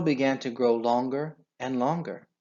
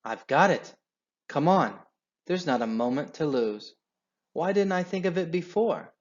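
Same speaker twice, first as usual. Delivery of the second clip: louder, higher burst of discovery; excited shout; 6 semitones higher, 3 dB louder than usual. A narrator's reading. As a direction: shout a sudden idea. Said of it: "He jumped up and shouted".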